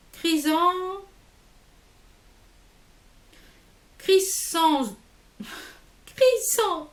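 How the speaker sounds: "Chrysan-, Crisson" sounded out high, piece by piece; struggling to pronounce. Real, not acted. Struggling to pronounce a product name. (« Chrysan- » déchiffré à voix haute et aiguë)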